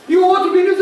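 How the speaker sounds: high, loud preaching at full force; fiery preaching. Acted. Preacher tells the congregation the shipwreck story, fiery and loud. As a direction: storm the story out from the pulpit.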